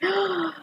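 sharp delighted gasp; excited gasp. Pretend. Host acting out surprise at the Falcon reveal, sharp gasp.

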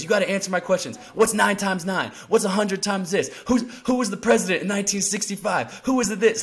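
retold yelling. Trainer yelling quiz questions in his ear, mock shout. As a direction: pepper him with questions like a drill coach. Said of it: "he's, like, yelling at me in my ear".

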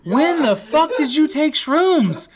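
very high, loud, drawn-out shriek; incredulous mock shout. Classmate reacting to shrooms confession, incredulous shouting. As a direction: shout it slowly in acted outrage.

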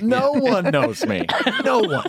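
mock dramatic. Pitching a joke show about himself.

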